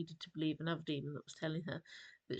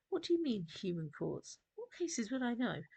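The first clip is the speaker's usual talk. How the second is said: sharp, higher surprised question; 5 semitones higher than usual. A narrator's reading. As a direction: ask it in disbelief. Oleander questions demon.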